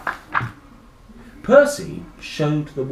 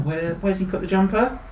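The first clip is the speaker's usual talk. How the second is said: ordinary delivery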